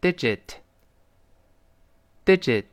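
digit said slowly and clearly, with a gap for repeats; deliberate, isolated "digit"; read-aloud model. Shown as a demonstration. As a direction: model "digit" for learners to echo. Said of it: "跟老师大声读一遍".

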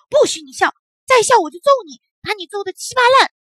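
furious high loud shouting; angry threat. Acted. Bully threatens to smash the tumbler doll, furious shout. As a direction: threaten it with all your might.

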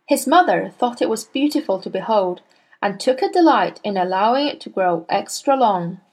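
ordinary delivery, nothing marked

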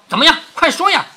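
high, loud, impatient prodding; exasperated urging. Acted. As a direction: hurry a stalling servant along. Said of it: "就是被他惹急了". (急躁地高声催促)

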